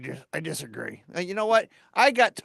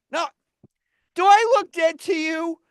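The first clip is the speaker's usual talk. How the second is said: high-pitched, offended challenge; indignant retort; 6 semitones higher, 3 dB louder than usual. Acted. Man told he looks dead, indignant. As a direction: throw the question back, affronted. Indignant at joke about his grave.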